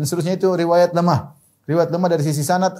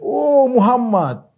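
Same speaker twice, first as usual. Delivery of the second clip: high, drawn-out cry of sighting; mock surprised cry; 8 semitones higher, much slower than usual. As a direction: imitate a lookout spotting someone, stretched out high. Orang Yahudi melihat Nabi datang. (seruan kaget yang tinggi dan panjang)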